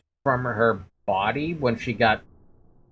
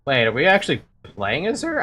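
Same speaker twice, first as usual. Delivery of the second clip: normal talking voice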